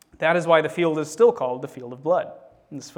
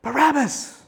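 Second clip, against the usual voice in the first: high, forceful shout; narrated mob shout; crowd shouting back; 9 semitones higher, 3 dB louder, slower than usual. A narrator's reading. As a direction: call it out like an answering crowd. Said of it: "And the crowd shouted back".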